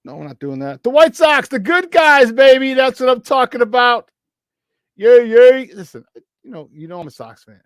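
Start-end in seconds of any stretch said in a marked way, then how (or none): 0.8-5.7 much higher and louder fan cheer; root for the good guys out loud; team cheer. Real, not acted. 5.7-7.6 quiet fan confession after the cheer; confiding drop. Real, not acted.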